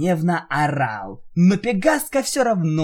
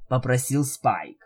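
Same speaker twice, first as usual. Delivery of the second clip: ordinary delivery, nothing marked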